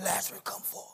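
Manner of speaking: whispered, breathless call; a whispered call from the tomb; hushed command. Retold or imitated. Jesus raising Lazarus, hushed.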